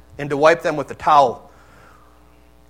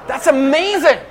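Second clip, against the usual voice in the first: joking Jesus with a laugh; laughing mock praise. Preacher joking as Jesus praising Peter, laughing. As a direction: tease Peter through laughter. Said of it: "what's Jesus gonna say next?".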